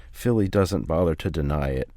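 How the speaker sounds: plain reading voice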